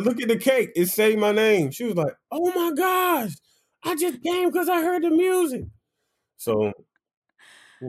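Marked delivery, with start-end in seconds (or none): 0.0-1.7 high-pitched, insistent mock protest; exasperated. Retold proof to disbelieving friend. 2.3-5.6 very high squeal of mock shock; astonished. Acts out friend's surprised realisation.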